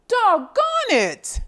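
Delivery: high, slow exasperated exclaim; mock frustration. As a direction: drag it out, playfully annoyed. Detective frustrated by theft.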